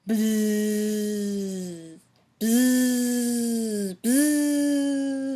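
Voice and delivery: long droning bzzz rising; sustained mechanical buzz; helicopter buzz. A narrator's reading. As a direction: imitate a helicopter buzzing. Helicopter noise imitated.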